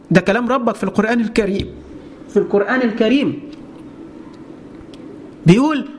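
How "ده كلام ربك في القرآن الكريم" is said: usual speaking voice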